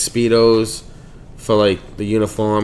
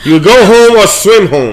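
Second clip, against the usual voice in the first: laughing tease; 9 semitones higher, 9 dB louder than usual. Real, not acted. Teasing friend about Speedos.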